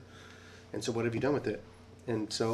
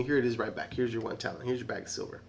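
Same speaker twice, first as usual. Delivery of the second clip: ordinary delivery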